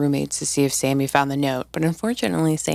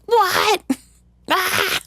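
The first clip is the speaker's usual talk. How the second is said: high, loud mock gasp and cackle; imitated maniacal laugh; 3 dB louder than usual. Imitating Mike hearing the news, high mock cackling gasp. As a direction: squeal and laugh at juicy drama. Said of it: "He's like".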